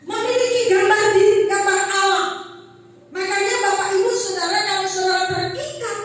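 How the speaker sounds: loud, chanting sermon voice; full-force preaching. Acted. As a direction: proclaim it to the whole church.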